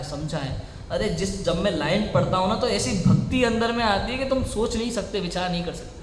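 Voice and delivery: ordinary talk, nothing marked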